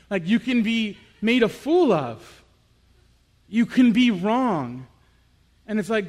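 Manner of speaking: loud, fervent sermon delivery; full-force preaching. Acted. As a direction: drive the story home to the congregation.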